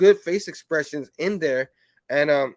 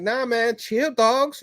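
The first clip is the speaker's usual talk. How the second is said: laid-back street slang, pitched a touch higher; slangy cool take; 6 semitones higher, slower than usual. Retold or imitated. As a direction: play it loose and casual, mate to mate. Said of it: "No, he didn't say it like that".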